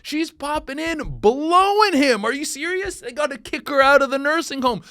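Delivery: high, loud disbelieving rant; incredulous shouting rant; rising outrage. Real, not acted. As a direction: build up the outrage, pitched high. Ranting in disbelief.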